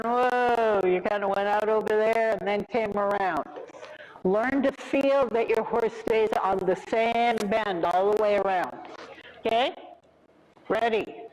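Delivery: usual speaking voice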